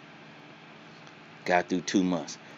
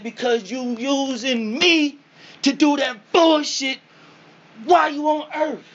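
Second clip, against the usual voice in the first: high, loud, slow preachy rebuke; the soul berates him in a high booming voice; scolding spirit; 9 dB louder than usual. Retold or imitated. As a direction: lecture him like a disappointed spirit.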